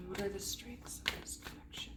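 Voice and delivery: very soft, voiceless whisper; whispered question. Real, not acted. Reader repeating the question about this connection, whispered.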